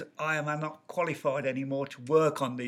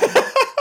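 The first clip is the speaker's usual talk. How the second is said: high laugh; 10 dB louder than usual. Real, not acted. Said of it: "nobody talks like that".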